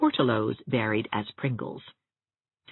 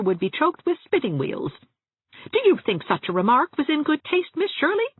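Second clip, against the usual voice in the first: put-on higher, chatty gossiping old dressmaker; higher-voiced prim spinster relishing every funeral; Miss Valentine; 5 semitones higher than usual. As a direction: gossip cheerfully about the dead.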